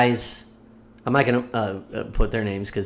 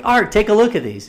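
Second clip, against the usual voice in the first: calling out; 9 semitones higher, 5 dB louder than usual. Retold or imitated. Calling buddy over.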